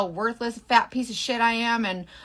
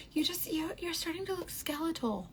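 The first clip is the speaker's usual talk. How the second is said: soft, higher, imitating a tentative critic; mock hesitant concern; 5 semitones higher, 11 dB quieter than usual. People commenting on her weight, mimicked as hushed hesitant concern.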